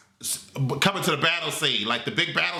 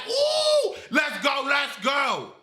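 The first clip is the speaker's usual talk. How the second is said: shouted hype, much higher; excited cheer; 8 semitones higher, much slower than usual. Retold or imitated. Fan cheering the pet on in the theater, excited shout. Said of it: "I'm like".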